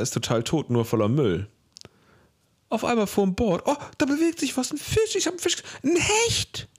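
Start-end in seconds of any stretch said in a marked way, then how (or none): 2.7-6.7 high, thrilled discovery; excited. Retold or imitated. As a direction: relive spotting the fish, very high. Retelling spotting a pike.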